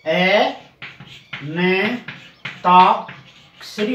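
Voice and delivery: ए न ताक्षरी slowly, with pauses between pieces; teacher sounding out ए न ताक्षरी; syllable-by-syllable demo. Shown as a demonstration. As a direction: draw out ता in ए न ताक्षरी. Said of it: "अंताक्षरी होता है".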